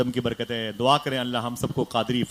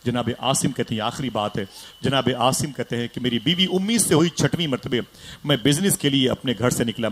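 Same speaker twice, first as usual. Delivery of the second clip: ordinary talk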